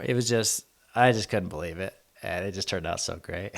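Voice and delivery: ordinary talk, nothing marked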